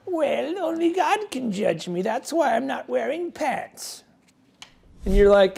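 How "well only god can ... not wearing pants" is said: lightly voiced smug vendor, higher; a hint of a preachy, self-righteous guy; smug troll voice. Vendor Smeagol justifying himself, mild smug mock voice. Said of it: "he's like".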